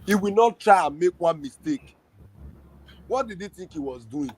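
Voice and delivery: ordinary talk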